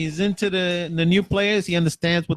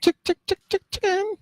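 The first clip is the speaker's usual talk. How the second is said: high clucking chicken voice. Pretend. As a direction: cluck it out like a hen.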